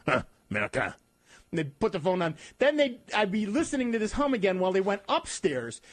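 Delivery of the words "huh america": French scoff. Retold or imitated. French relative mocking caller.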